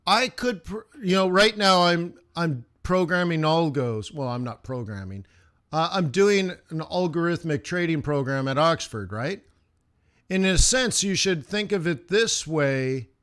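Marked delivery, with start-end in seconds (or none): none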